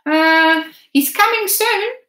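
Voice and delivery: put-on high, loud boy's voice, a hesitant "Uh"; boy lying. A narrator's reading. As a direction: fumble the lie with a nervous start. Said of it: "I had to lie as well".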